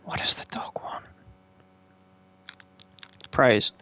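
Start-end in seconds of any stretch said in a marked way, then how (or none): 0.0-1.0 whispered question, breathy and soft. Real, not acted. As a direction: hush it to a whisper.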